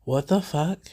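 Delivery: normal talking voice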